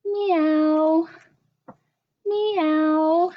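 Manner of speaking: high meowing cat voice. Shown as a demonstration. Librarian showing kids the cat sound, high meow. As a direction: mew it out like a kitten. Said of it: "All I know is cats go".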